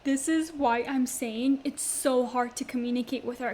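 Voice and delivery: ordinary talk, nothing marked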